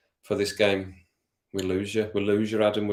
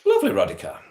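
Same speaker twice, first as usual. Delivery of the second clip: delighted; 5 dB louder, faster than usual. Real, not acted. Thanking viewer.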